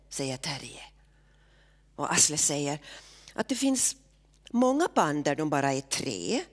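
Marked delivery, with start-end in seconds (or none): none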